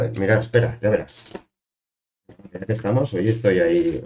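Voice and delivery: ordinary talk, nothing marked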